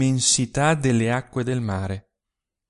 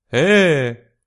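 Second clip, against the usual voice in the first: coarse reply; 4 semitones higher, 8 dB louder than usual. A narrator's reading. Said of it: "una voce grossolana rispose".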